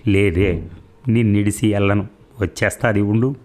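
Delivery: usual speaking voice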